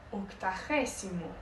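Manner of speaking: octagésimo with a rasped Spanish j and a clear s, ok-ta-HE-si-mo; Spanish pronunciation. Shown as a demonstration. Teacher contrasting Spanish with Portuguese, Spanish pronunciation. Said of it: "em espanhol".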